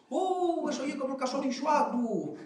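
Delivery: high, hooting mock disbelief; dismissive village scoff. Villagers scoff at something that will never happen, dismissive hooting. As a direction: hoot it high, without extra volume. Mimics villagers' saying about impossible things.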